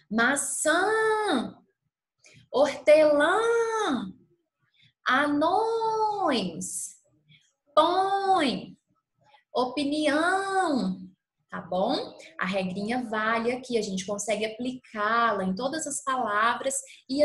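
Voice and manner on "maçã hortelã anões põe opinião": "maçã, hortelã, anões, põe, opinião" called high with long nasal endings; calling out the word. Shown as a demonstration. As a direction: call each word, stretching the final ÇÃ, LÃ, NÕES, PÕE, ÃO high. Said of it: "de chamar a palavra". (chamando "maçã, hortelã, anões, põe, opinião", última sílaba aguda e esticada)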